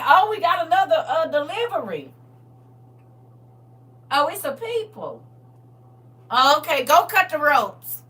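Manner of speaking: amused high announcing voice; mock receptionist. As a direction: play the one receiving a delivery. Mock intake of new arrivals.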